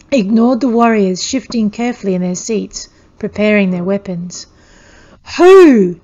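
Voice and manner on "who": loud, high roar. A narrator's reading. Warrior roaring while shaking the child, loud and high. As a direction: roar it in her face. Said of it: "he roared".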